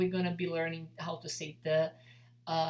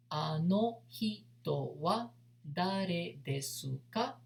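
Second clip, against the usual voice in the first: slow demo; slower than usual. Shown as a demonstration.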